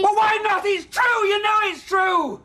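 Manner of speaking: high, shouted protest; angry shout. Acted. Father shouting at daughter that it's Deirdre he wants, angry. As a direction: hurl the truth at her.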